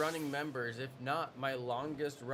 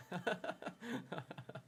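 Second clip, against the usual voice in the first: laugh; 7 semitones higher, 8 dB quieter than usual. Real, not acted. Laughing at own wrestling jokes.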